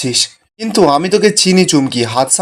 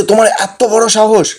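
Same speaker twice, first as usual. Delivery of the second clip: higher-pitched indignant challenge; mildly acted anger, pitch raised; angry outburst; 5 semitones higher than usual. A narrator's reading. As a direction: confront them in outraged disbelief. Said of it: "খুব রেগে যায়".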